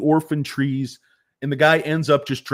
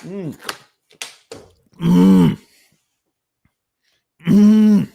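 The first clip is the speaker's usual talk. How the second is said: mock thoughtful hum; 4 semitones higher, 9 dB louder, much slower than usual. Mock pondering hums.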